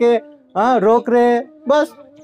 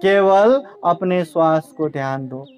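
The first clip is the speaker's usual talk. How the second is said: lower, calm breath-guiding voice; meditation guidance; 5 semitones lower than usual. Acted. Buddha guiding the Brahmin to watch his breath, low and calm. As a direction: lead the breathing gently, sinking lower and fading. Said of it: "Buddha कहते हैं".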